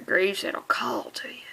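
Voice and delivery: whispered spooky promise; old woman's creepy whisper; ominous whisper. Retold or imitated. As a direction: hiss it like a curse. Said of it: "She says".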